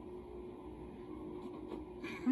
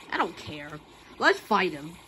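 usual speaking voice

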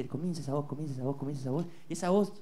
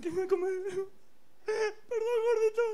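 high, whiny fake crying; mock sobbing apology; 13 semitones higher than usual. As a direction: blubber an apology.

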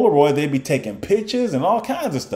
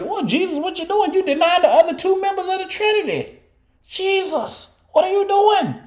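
much higher, incredulous mock questions at Jesus; 12 semitones higher than usual. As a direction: squeak it up high like a scandalised believer.

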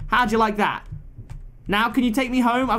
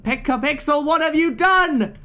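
slow, high, louder accusing cry; exasperated character; 8 semitones higher, 3 dB louder than usual. Acted. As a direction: demand it of the captain, dragged out in dismay. Crew member blames captain Pixel.

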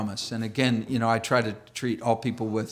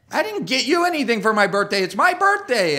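put-on indignant kid; 9 semitones higher, 6 dB louder than usual. Kid protests on birthday.

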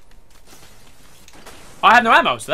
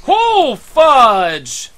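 loud, high-pitched cry of dismay; dismayed shout; 10 semitones higher, 13 dB louder than usual. Real, not acted. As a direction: shout it long and high in frustration. Shocked outburst during the game.

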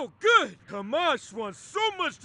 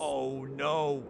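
ordinary delivery

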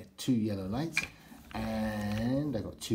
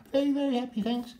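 very high, louder, gushing happy toy; squealing delight; 5 dB louder, faster than usual. Pretend. Toys say they are happy.

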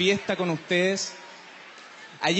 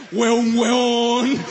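mock shout; 5 dB louder than usual. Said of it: "es el primero en gritarte".

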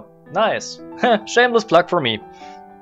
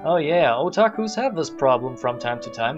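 usual speaking voice

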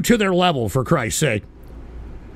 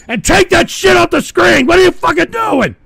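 high, loud outraged yell; angry shout; 7 semitones higher, 5 dB louder than usual. Real, not acted. Host ordering something off the screen, angry shouting.

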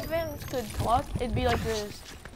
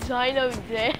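ordinary delivery

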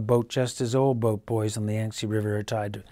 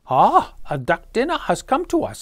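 higher, louder voiced father; a father pleased at his catch; 12 semitones higher, 7 dB louder than usual. Boy's father eyeing the duck for dinner, gleeful. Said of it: "said the boy's father".

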